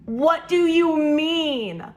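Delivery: slow, drawn-out, high protest; long incredulous wail; exasperated disbelief. Retold or imitated. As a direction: stretch every word in outraged disbelief. Outraged response to parents siding with sister.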